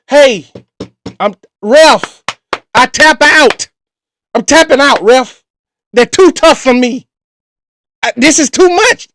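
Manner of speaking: loud, hammed-up cry to the referee; mock tap-out plea. Host pretends the caller's story beat him, loud mock surrender. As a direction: beg the ref to stop the fight, half joking.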